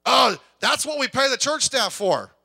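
high, loud, slow mocking whine; hammed-up dismissive churchgoer; mock whiny excuse. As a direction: sneer the excuse like a lazy member. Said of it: "quoting excuse of churchgoers".

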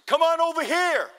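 high, loud call across a distance; imitated yell. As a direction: holler it over to them. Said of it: "I'm gonna yell at 'em".

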